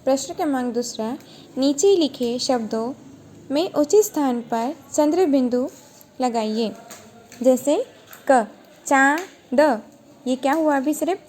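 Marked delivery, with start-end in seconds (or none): none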